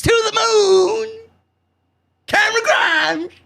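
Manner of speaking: high, slow hammed-up hype call; Cameron Grimes catchphrase. Recapping Grimes' interview, mimicking his high, slow hype shout. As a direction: belt it out like an over-the-top wrestler.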